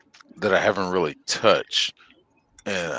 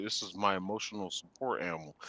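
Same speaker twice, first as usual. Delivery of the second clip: deadpan mumble; 14 dB quieter, faster than usual. Pretend. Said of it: "I'm like".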